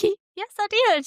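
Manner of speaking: high Southern drawl, lightly hammed up. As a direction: imitate your own high Georgia drawl. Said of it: "you got the draw".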